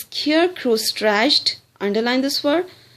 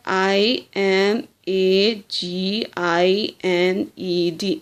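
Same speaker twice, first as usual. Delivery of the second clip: slow letter by letter spelling; spelled out slowly; 2 semitones lower, slower than usual. Shown as a demonstration. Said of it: "What's the spelling of this word?".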